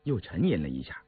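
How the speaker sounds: plain reading voice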